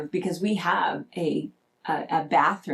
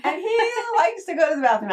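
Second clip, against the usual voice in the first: laughing; 10 semitones higher, 3 dB louder, faster than usual. Real, not acted. Laughing disbelief about husband.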